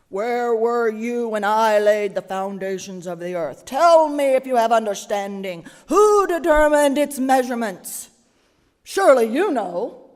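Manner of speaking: loud, booming God thundering the questions; big voice. Shown as a demonstration. God thundering at Job from the storm, loud booming. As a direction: boom it out, harsh and mighty. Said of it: "read it in the big voice".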